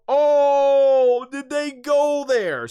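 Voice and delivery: high, loud mock-shocked "oh, did they go there"; shocked gasp. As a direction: act scandalised, pitch jumping up. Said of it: "You were like".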